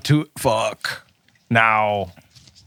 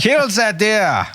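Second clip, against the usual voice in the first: slow mock officer; 10 semitones higher, much slower than usual. Joking officer order.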